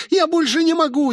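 very high despairing cry; frantic high girl's voice; panicked squeal. Acted. Rosie breaks down screaming.

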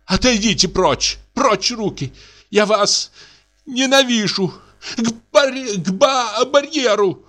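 breathless, flustered defiance; flustered protest. Acted. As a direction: protest while half giving in. Widow fends off embrace, flustered.

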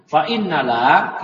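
Fa innala read slowly and clearly as the correct reading; slow, careful Fa innala; model reading. Shown as a demonstration. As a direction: recite Fa innala slowly to show it.

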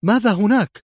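high, startled query; startled cry. A narrator's reading. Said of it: "وهتفت".

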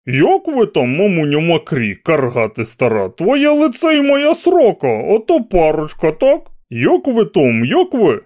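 a mocking spectator's shout; shouting heckler. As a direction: jeer at him from the crowd. Shouting crowd heckler quote.

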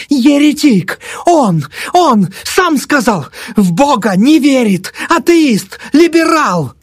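very high, drawn-out shriek; screeching old woman. A narrator's reading. Thrashing old woman denounces the doctor, screeching. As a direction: screech it as a denunciation. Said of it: "верещала".

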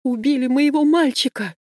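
high wailing cry of grief; anguished scream. A narrator's reading. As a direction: scream over the dead son. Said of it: "кричит".

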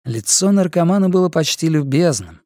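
ordinary delivery

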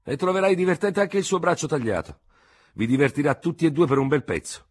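lieutenant sneering at the doctor; harsh sarcasm. Acted. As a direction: turn his word against him.

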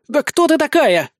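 high-pitched indignant snarl. A narrator's reading. Captive demanding who her rival is, snarling. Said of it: "рычит Нелли".

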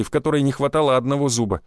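plain reading voice

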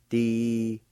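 "Di-hli-ya" drawn out, one syllable at a time; syllable-by-syllable pronunciation. Shown as a demonstration. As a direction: sound out "Di-hli-ya" slowly with gaps between syllables. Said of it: "First part is pronounced like this".

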